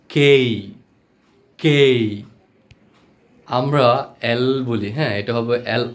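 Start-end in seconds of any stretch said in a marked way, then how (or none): 0.1-2.0 "কেই" loud, with a full glide at the end; emphatic, drawn-out "কেই"; corrected letter K. Shown as a demonstration. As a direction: punch out "কেই" and hold the glide. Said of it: "এটা উচ্চারণটা হবে".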